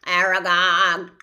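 loud spider call across the hollow. Acted. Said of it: "it called".

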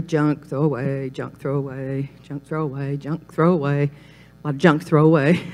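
low, soft, repetitive sorting chant; hushed, rote listing under the breath; absent-minded muttering. Acted. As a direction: talk to yourself while tossing junk mail. Acting out sorting the mail.

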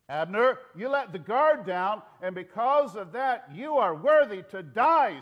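forceful yelled reprimand; shouted rebuke. Acted. Acted rebuke yelled to guard.

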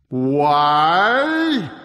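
loud, drawn-out giant voice; booming giant echo. A narrator's reading. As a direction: echo the question in a huge voice. Giant echoes the question.

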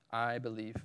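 soft, low statement of belief; quiet statement. Acted. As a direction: say it low and softly. Quoting Martha's answer, first take.

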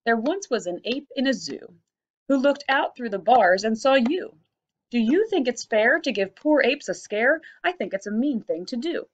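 rhythmic limerick reading; bouncy poem recital. Shown as a demonstration. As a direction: read it like you're clapping the beat. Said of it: "Let's see if we can clap this one out".

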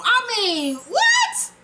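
squeaked very high in disbelief; can't believe it, pitch it way up. Real, not acted. Disbelief at Ghost's move.

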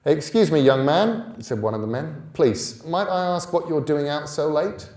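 put-on officer questioning a boy at night; policeman's voice. A narrator's reading. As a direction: stop a boy as a constable.

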